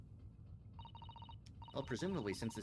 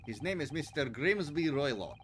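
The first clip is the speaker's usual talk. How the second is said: put-on Russian accent; 4 dB louder than usual. Russian sailor character.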